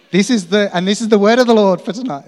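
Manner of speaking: loud, high, fast preacher declaration; half-joking sermon announcement; preaching proclamation. Acted. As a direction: proclaim it from the pulpit. Proclaims a prophetic word.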